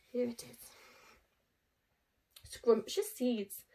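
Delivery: usual speaking voice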